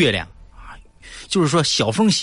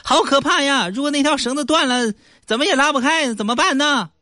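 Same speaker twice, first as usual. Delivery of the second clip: mock worried reporter, much higher; high-voiced reporter; 14 semitones higher than usual. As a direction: ask fearfully in a high voice. Reporter frightened question. (高声装害怕的记者)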